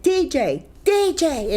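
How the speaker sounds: high-pitched retold shout of a name; retold calling out. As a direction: imitate calling someone to wake up. Father calling out to rouse son.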